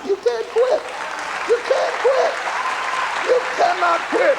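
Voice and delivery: emphatic, high-pitched preacher climbing to a straining shout; full-force preaching. Real, not acted. Preacher urging listeners not to quit on life, impassioned shout. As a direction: drive each exhortation home with rising fervour.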